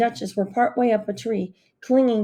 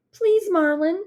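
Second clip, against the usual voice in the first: higher pleading voice; begging; 9 semitones higher, slower than usual. A narrator's reading. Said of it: "she begged me".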